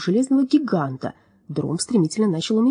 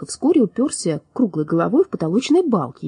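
ordinary delivery

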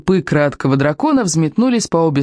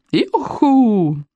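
higher, drawn-out whoop; excited squeal; 5 semitones higher than usual. A narrator's reading. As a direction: whoop as the ride takes off. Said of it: "взвизгнула Камикадзе". (азартный протяжный клич)

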